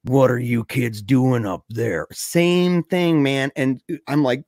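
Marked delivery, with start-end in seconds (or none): 0.0-2.1 low, slow menacing stranger; ominous low drawl; creepy old truck driver. Retold or imitated. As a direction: drag it out deep like a scraggly old man. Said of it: "that guy comes up and he goes".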